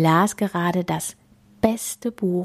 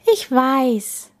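mildly sighed admission in a higher voice; sighing; 7 semitones higher than usual. A narrator's reading. Bella conceding to the space king, lightly sighing. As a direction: concede with a touch of weariness. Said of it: "seufzte Bella".

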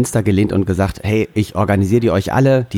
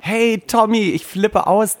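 excited; 8 semitones higher, slower than usual. Acted. Mock fan greeting on phone.

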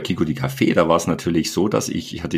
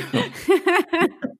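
bright laugh. Real, not acted. Laughing at the monk quote.

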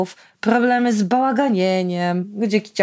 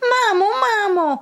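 put-on much higher whiny child's wail; boy wailing; 12 semitones higher than usual. Boy calling his mother for help, whiny wail. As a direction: howl for mummy like a spoiled kid. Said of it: "zawył chłopiec".

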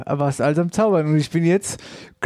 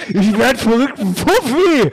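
rising, louder fake astonishment; mock amazement; 5 semitones higher, 3 dB louder, slower than usual. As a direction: act stunned by the trick.

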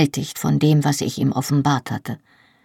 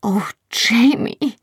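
higher, slowed heartbroken cry; Oh, Jamie drawn out high and slow in grief; 6 semitones higher, slower than usual. A narrator's reading. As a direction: cry out his name as your heart breaks. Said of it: "wie mir die Einsamkeit das Herz brach".

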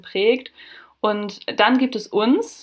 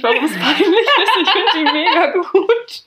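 higher, louder, laughing through words; 8 semitones higher, 5 dB louder than usual. Real, not acted. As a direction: laugh while admitting it. Laughing at her own confusion.

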